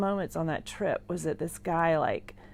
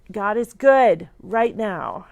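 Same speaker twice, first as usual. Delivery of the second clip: fervent declaration; 4 semitones higher, 10 dB louder, slower than usual. Real, not acted. Declaring faith in hospital.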